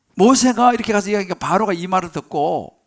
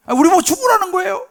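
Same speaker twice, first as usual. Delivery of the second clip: high, loud, indignant question; resentful outburst; 11 semitones higher, 5 dB louder than usual. Acted. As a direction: protest like people pushed too far. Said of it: "원망과 불평이 막 터져 나오는". (억울해서 높게 터뜨리는 불평)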